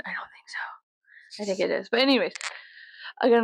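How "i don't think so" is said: whisper to mic. Real, not acted. Scolding the microphone.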